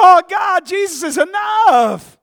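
high, loud, drawn-out cry; preacher reliving an exultant outburst; shouted praise. Retold or imitated. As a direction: holler it up to God. Reliving his praise in the car.

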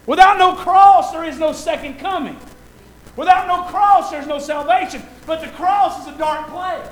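high, loud and slow, almost shouting; whooping sermon peak; pump-up preaching. Real, not acted. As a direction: preach it full force to lift the crowd. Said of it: "I'm trying to pump you up speech".

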